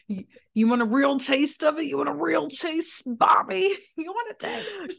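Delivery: a hint of a taunting Krampus; Krampus threat. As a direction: tease Bobby with a hammed-up monster taunt. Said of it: "threaten him a little bit on Krampus's behalf".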